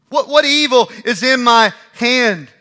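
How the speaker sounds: high, loud exasperated plea; loud pleading call. Acted. David calling across to Saul, loud and exasperated.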